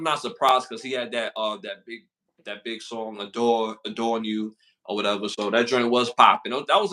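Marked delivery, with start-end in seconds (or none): none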